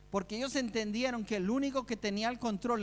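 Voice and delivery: ordinary talk, nothing marked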